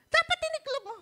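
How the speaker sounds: high, loud scolding imitation; imitating wife's scolding. Preacher replaying wife's scolding, high and loud. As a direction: throw it out like an annoyed wife. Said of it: "Sabi niya sa akin".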